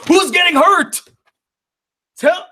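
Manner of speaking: loud, high-pitched indignant demand; outraged outburst. Real, not acted. YouTuber snapping back at a critic's letter, outraged.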